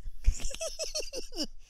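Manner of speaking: shrill canned-style cackle after the punchline; high cackle. Real, not acted. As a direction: burst into a high cackling laugh. Host laughs at own joke.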